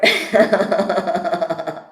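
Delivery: a loud laugh at the joke. Real, not acted. Guest laughs loudly at being ninety-four. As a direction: let the laugh ring out.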